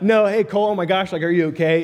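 high-pitched retold concern; mock alarmed bystander. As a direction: imitate a worried onlooker calling out. Onlookers react to his fall, retold.

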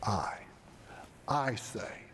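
"I" low and alone, pause, then "I say"; hushed, weighty stress on "I"; emphatic I. Retold or imitated. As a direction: set "I" apart with a long pause, then repeat it. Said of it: "by the use of that emphatic I".

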